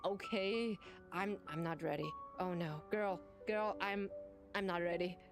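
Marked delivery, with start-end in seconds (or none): none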